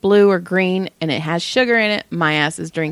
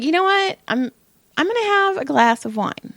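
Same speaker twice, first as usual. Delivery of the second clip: higher, chirpy self-quote; excited decision; 10 semitones higher than usual. Retold or imitated. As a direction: perk up, treat yourself. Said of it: "So I was like".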